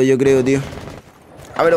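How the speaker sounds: usual speaking voice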